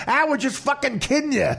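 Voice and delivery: high-pitched retold punchline, amused; excited prank reveal. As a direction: burst into the reveal, voice lifted high.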